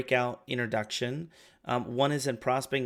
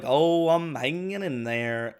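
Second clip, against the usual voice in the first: slow, drawn-out "hanging in there", louder than usual; exaggerated voice inflection; 3 semitones higher, 5 dB louder, slower than usual. Shown as a demonstration. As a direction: stretch "Oh, I'm hanging in there" for comic effect. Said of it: "I'll use this voice inflection".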